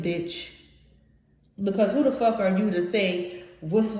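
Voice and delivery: normal talking voice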